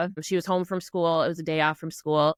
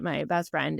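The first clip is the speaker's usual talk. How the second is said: normal talking voice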